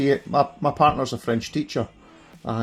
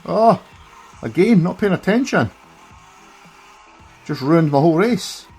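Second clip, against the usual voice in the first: loud, higher frustrated outburst; 7 semitones higher, 6 dB louder than usual. Real, not acted. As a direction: groan at your own mistake. Crashes during a race.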